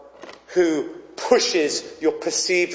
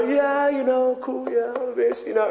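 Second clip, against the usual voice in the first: very relaxed; 5 semitones higher, 5 dB quieter than usual. Retold or imitated. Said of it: "very relaxed".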